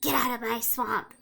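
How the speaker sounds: raspy, scratchy little creature voice; small scraggly voice. A narrator's reading. Hidden creature warning you out of its swamp, scraggly voice. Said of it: "small, kind of scraggly voice".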